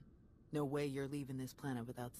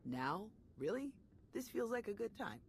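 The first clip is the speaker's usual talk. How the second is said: pitched-up mock surprise, dripping sarcasm; higher sarcastic jab; 8 semitones higher than usual. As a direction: roll your eyes through it. Sarcastic reply to the master.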